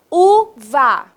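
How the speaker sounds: "Uva" split into U and va; syllable by syllable. Shown as a demonstration. Teacher helps pupils find the first letter, syllable by syllable. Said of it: "é qual letrinha?".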